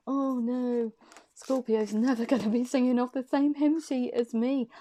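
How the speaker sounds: mock dismay, voicing someone's worried thought; acted dismay. Reader voicing the partner's worry about Scorpio, mock dismay.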